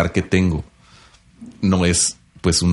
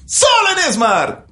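booming promo sign-off, slower; supermarket ad read; slower than usual. Shown as a demonstration. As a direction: stretch the store name like the end of a radio spot. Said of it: "Di que la pierna de pescado está dos por uno".

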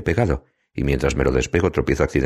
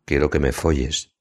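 ordinary delivery

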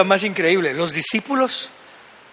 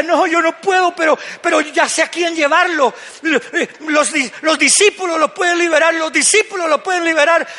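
father's reply; 6 semitones higher than usual. Acted. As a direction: answer as the hopeful dad. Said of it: "Y el papá decía". (un padre esperanzado, algo más agudo)

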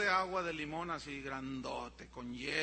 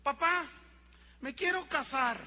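put-on higher-pitched child's announcement; small child announcing; 5 semitones higher, 4 dB louder than usual. Little boy tells dad he wants to marry, high bold announcement. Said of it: "llega un niño y dice".

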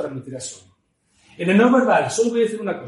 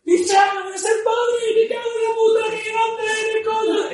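southern Spanish; 14 semitones higher than usual. Acted. Joyful southerner shouting to a friend about becoming a father. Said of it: "Cambio del sur es más aguda, alargamos las palabras".

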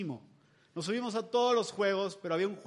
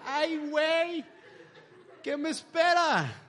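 higher, slow, half-laughing whine of dread; mock dread; 9 semitones higher, 4 dB louder, slower than usual. A dad in line eyeing soaked riders, in a mock dreading whine. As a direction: play up the fear of what's coming, chuckling. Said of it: "Y decía".

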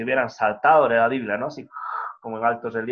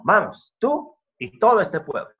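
rousing command; 8 semitones higher, 5 dB louder than usual. Acted. Preacher voices God urging Joshua.

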